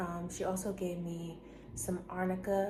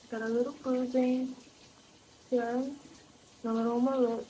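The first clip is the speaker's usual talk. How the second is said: normal talking voice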